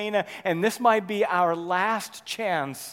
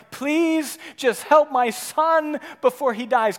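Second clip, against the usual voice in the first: slow, pleading, higher; desperate plea; 8 semitones higher, 7 dB louder, slower than usual. Acted. Official begging Jesus, mild pleading. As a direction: beg for your son's life. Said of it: "Can you hear the desperation in his voice?".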